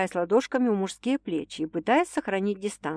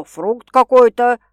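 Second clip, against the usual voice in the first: mock offended; 4 semitones higher, 12 dB louder, slower than usual. A narrator's reading. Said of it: "обиженно уточнил он".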